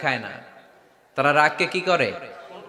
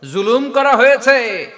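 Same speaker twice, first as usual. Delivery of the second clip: sudden high, loud outburst; impassioned cry; 7 semitones higher, 8 dB louder, slower than usual. Real, not acted. Preacher listing wrongs done to the Prophet, crying out loudly. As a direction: lift the voice sharply to a peak.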